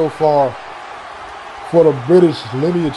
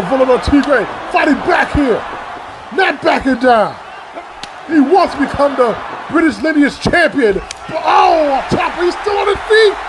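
higher and higher play-by-play; excited call; 9 semitones higher than usual. Real, not acted. Commentator hyping the challenger's comeback, rising excitement. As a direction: build the hype as he refuses to fall.

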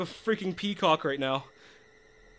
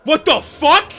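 much higher, much louder shriek; startled shout; 9 semitones higher, 12 dB louder, slower than usual. Real, not acted. Player jolted by the game, screaming in shock. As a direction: yell out in real shock.

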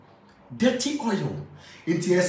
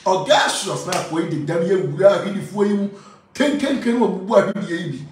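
calling out; 5 semitones higher, 5 dB louder than usual. Acted. Acted call to Augasius.

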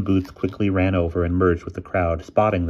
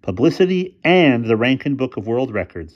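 louder, higher, proud announcement; great satisfaction; 6 semitones higher, 6 dB louder than usual. A narrator's reading. Boy tells friends about the publicity, very satisfied. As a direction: announce it with relish. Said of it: "with great satisfaction".

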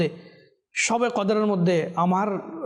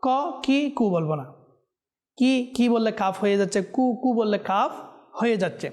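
usual speaking voice

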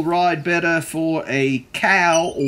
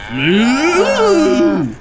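a lowing moo, louder; cow moo; 8 semitones higher, 5 dB louder than usual. A narrator's reading. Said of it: "said Cow".

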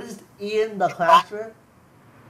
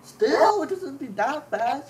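high, drawn-out complaint; incredulous protest; 8 semitones higher, 3 dB louder, slower than usual. Real, not acted. Player complaining about server ping, incredulous. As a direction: protest in a raised, stretched voice.

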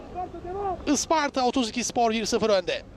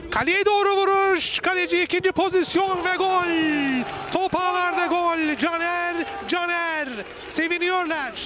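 screaming football commentator; 5 semitones higher than usual. Real, not acted. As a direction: erupt as the ball hits the net. Live goal call, commentator erupts. (coşkulu gol anlatımı)

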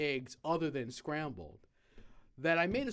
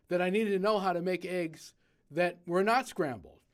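ordinary talk

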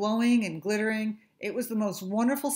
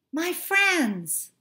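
excited cry; 10 semitones higher, 6 dB louder than usual. Acted. Fish realizes friends passed.

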